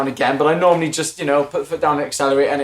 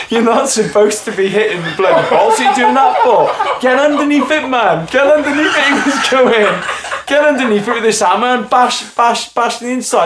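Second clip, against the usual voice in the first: half-laughing, raised scolding; neighbour laughing; 6 semitones higher than usual. Retold or imitated. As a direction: tell him off while laughing at him. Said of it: "and said".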